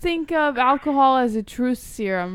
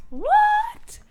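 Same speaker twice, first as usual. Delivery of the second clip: surprised. Real, not acted. Shocked reaction, repeated.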